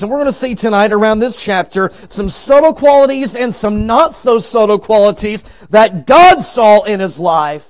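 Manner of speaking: ordinary delivery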